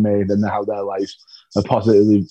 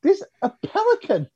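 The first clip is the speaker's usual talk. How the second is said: high, excited squeaky voice climbing higher; giddy disbelief; 12 semitones higher than usual. Retold or imitated. Guest tells producers he is too happy, high and giddy. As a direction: gush like a kid in paradise. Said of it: "And I was like".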